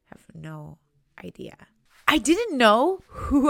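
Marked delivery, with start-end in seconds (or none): 0.1-1.6 lost quiet reply. Retold or imitated. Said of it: "And I was like".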